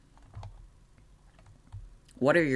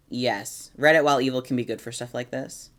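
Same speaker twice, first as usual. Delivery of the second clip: ordinary talk, nothing marked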